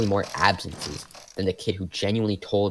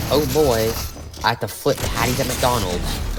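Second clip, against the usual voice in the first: higher, louder mock despair; mock dread; 5 semitones higher, 5 dB louder than usual. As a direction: groan it out in fake dread. Dreading a fast food job.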